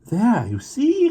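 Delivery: high, slow, triumphant "there, you see"; a child's I-told-you-so cry, drawn out. A narrator's reading. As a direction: crow it out in relief. Anna triumphant outburst.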